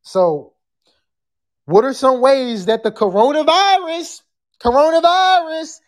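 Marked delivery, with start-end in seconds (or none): 2.9-5.8 raised, showy catchphrase voice. Acted. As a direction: stretch it out high like the viral rapper. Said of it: "in my [name] voice".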